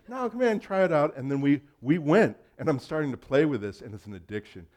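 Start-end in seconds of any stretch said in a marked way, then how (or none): 0.1-1.1 higher, louder, coaxing friend; eager invitation. Retold or imitated. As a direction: pull him in with enthusiasm. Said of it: "Rich inviting to try Apple".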